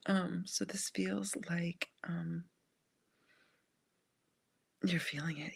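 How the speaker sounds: hushed, gentle whisper; tender whisper. Real, not acted.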